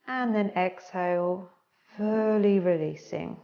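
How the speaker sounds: slow and hushed, breath by breath; guided breathing cues. Acted. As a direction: talk them gently through each breath.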